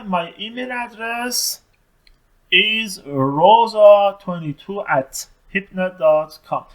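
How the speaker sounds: very slow, clear English answer; slow model answer. Shown as a demonstration. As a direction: spell the address out slowly. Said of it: "جواب داده". (پاسخ انگلیسی خیلی آهسته)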